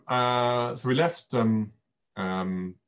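ordinary delivery

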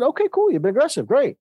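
overly cheery acceptance, tongue in cheek; mock upbeat. Dad answers son's defensive claim.

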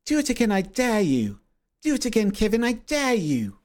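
hammed-up, high South African accent; South African coach. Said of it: "and she'd be like".

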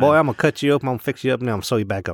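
put-on folksy surgeon in a thick Southern accent. Surgeon reassures a new patient, thick Southern. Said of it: "have a very thick Southern accent".

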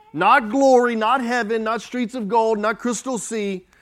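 each "not" punched hard, starting loud and easing down; emphatic denials. Acted. Preacher to church, emphatic listing that dies away. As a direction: hammer the word "not" on every item. Preacher rejecting false comforts.

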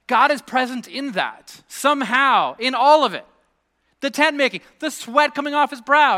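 loud, high-pitched preacher's proclamation; impassioned preaching. Real, not acted. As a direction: preach it out loud, each phrase driven home. Preaches at full force.

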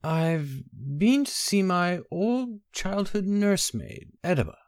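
slow, dazed, high strange voice. A narrator's reading. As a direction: speak slowly as if in a daze. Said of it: "he said in a strange voice".

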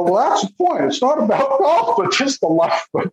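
high, insistent explaining; eager explaining. Retold or imitated. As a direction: make your point with lifted pitch. Retold explanation to golfer.

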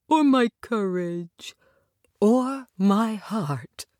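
slow, drawn-out lament; mild wail. A narrator's reading. Tin Man laments his lost heart, gently wailing. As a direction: stretch each word into a plaintive wail. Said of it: "wailed the Tin Man".

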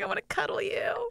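high, breathless whisper. Retold or imitated. Gushing at a cute video, whispered high. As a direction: whisper it like you want to squeeze him. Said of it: "I was like".

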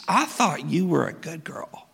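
hushed, scolding whisper; disapproving whisper. Acted. A gossiping neighbour judging Mary, in a scolding whisper. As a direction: whisper it like gossip behind her back.

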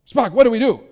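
loud, high, overacted captain's plea; hammed-up Captain Kirk. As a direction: call out in mock-dramatic alarm. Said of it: "super overacts all the time".

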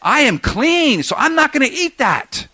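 self-righteous refusal, acted out; indignant Peter. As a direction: insist on each claim, pitch swooping up.

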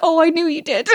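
high, cooing reply to a cat; baby talk. Retold or imitated. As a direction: ironic pet-owner coo. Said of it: "baby talk to their dog".

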